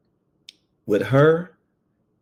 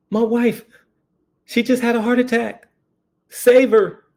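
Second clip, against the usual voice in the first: high, frantic begging that breaks loud on save her; imitating a desperate old man pleading at the door; panicked plea; 11 semitones higher, 4 dB louder than usual. Old man begs deputy for help.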